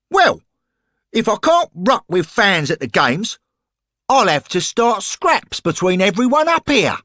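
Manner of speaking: high, loud and fast lairy thug; football hooligan voice. Acted. Lamper tells Henry the match went wrong, rowdy hooligan voice. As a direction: bark it like a rowdy terrace lad. Lamper threatens to start fights.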